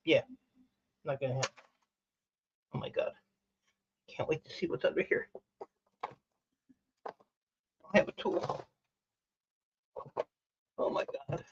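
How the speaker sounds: softly working on the part; hushed. Real, not acted. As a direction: murmur while concentrating on the tool. Hushed concentration while prying.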